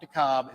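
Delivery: DeKalb as "duh-KOB", loud on the second syllable; wrong pronunciation. Shown as a demonstration. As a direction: punch DeKalb out as "de-KAHB". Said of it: "not DeKalb".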